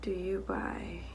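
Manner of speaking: whisper. Real, not acted. Whispering the prompt to herself.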